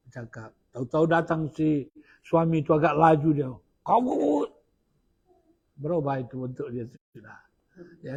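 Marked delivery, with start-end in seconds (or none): 3.8-4.1 sharp high yell at his wife; retelling an angry husband bursting in. Retold or imitated. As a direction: snap his wife's name out loud.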